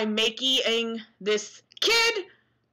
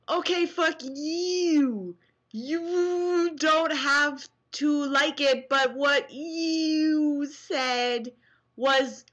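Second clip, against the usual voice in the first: mocking hater voice; 7 semitones higher, slower than usual. Reads hater comment mockingly.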